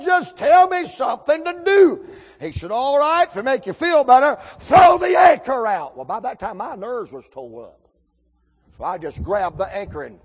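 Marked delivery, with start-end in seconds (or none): 0.0-2.1 high, loud frantic plea; hammed-up desperate holler; mock desperation. 2.7-6.0 high, loud, building to a shout; retold shouted command; hollered order. Exasperated order in the boat.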